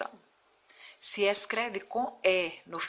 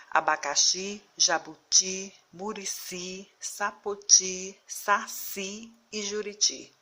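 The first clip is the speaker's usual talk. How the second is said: abacaXI, jabuTI, saCI, weight on the final I; stress on last syllable; slower than usual. Shown as a demonstration. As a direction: read Abacaxi, sapoti, juriti slowly, pushing each last syllable. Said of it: "a última sílaba é pronunciada com mais força". (Abacaxi, jabuti, juriti com a última sílaba forte)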